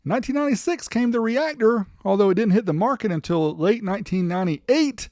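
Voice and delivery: ordinary delivery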